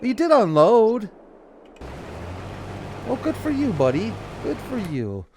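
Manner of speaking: sarcastic good for you, buddy. Real, not acted. Teasing a farm worker.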